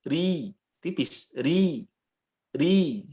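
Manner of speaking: ri light and thin; Arabic thin ri. Shown as a demonstration.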